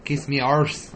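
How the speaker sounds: a hint of a Scottish accent on "Kiss me arse."; a clumsy mock Scottish taunt; bad Scottish accent. Host admits he's bad at accents, mock Scottish jibe. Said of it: "I'm bad at accents".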